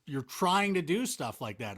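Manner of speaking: ordinary talk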